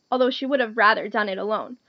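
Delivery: usual speaking voice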